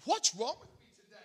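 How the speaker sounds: amused self-scolding; laughing at himself. Real, not acted. Pastor teases himself after a joke, laughing.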